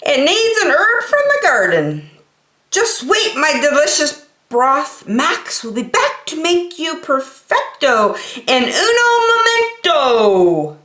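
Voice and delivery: high duck voice promising the soup; cheerful cartoon duck with a mock Italian flourish; proud little chef. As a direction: chef talks fondly to his pot. Max talks to his broth.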